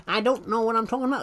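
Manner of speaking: lightly put-on high frog voice for the stream greeting; Kermit the Frog. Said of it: "I sound like Kermit the Frog".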